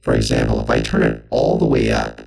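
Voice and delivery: pitched far down, synth robot; robotic voice effect. Shown as a demonstration. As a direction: vocoder filter on the voice. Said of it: "you can hear it coming in".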